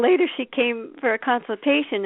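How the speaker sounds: ordinary talk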